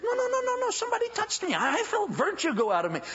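high, firm insistence; insistent Jesus. Acted. Jesus insisting to the apostles that someone touched him.

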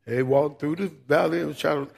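mock funeral mourner. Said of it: "at the next funeral".

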